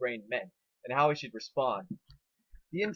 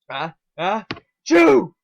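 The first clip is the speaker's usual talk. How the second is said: loud sneeze; 6 semitones higher, 14 dB louder, much slower than usual. A narrator's reading. Spike sneezes.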